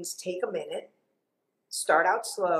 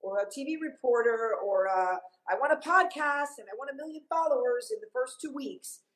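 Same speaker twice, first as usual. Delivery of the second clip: high, eager entitled grad; lightly voiced naive young graduate; 6 semitones higher than usual. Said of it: "they think".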